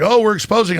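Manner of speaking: high-pitched imitation of feigned discovery; mock confession. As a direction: put on a mocking air of revelation. Voices the magazine's confession.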